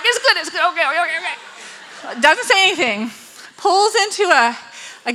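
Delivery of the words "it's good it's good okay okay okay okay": mock hurried reassurance; awkward comforting dad. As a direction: imitate a flustered dad soothing someone crying. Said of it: "He's like".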